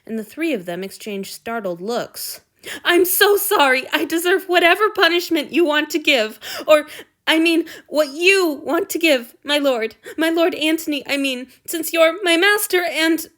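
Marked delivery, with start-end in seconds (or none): 2.9-13.3 high, loud wretched wailing. A narrator's reading. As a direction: babble out apologies in distress. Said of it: "the young man wailed".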